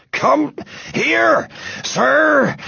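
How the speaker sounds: high, winded broken-up call; panting. A narrator's reading. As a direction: gasp out a command between breaths. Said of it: "panted Mister Grumby".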